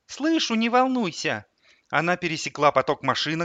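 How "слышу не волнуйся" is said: put-on high, slow little-boy voice; calm child. A narrator's reading. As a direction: reassure mum as an oddly calm boy. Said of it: "этого неожиданно спокойного голоса". (спокойный детский голос)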